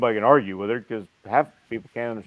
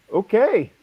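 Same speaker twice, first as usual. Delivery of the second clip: taken aback okay; 9 semitones higher, 4 dB louder than usual. Retold or imitated. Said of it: "You're just going".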